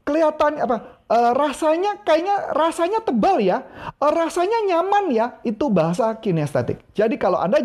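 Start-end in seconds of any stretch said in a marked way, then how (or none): 0.1-5.3 much higher, feeling-words voice; kinesthetic talker. Shown as a demonstration. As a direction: act out someone savouring sensations. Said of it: "Kalau kinestetik bilang gini". (meniru orang kinestetik dengan suara tinggi)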